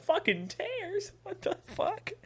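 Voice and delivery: very high, drawn-out mock disbelief; incredulous disbelief. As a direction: squeak it in appalled disbelief. Retold patient hears her diagnosis.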